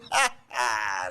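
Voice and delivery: high-pitched put-on laughter; mock disciple's laugh. Disciple laughing at the guru's own joke, high mock laughter. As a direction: laugh along to flatter the guru.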